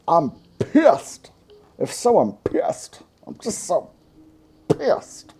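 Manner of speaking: mock fuming, with a high, explosive P on "pissed"; hard P's. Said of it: "say a bunch of hard P's".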